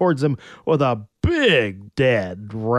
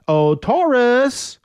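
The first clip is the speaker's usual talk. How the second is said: calling out; 11 semitones higher, slower than usual. Acted. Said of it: "he called".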